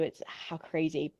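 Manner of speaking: ordinary delivery, nothing marked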